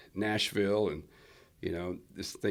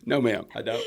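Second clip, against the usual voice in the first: laughing reply; 3 semitones higher, 5 dB louder than usual. Retold or imitated. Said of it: "I was like".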